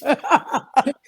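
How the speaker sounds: words broken up by laughter; giggling while retelling the mishap; laughing. Real, not acted. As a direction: laugh your way through the restart. Laughing over her own story.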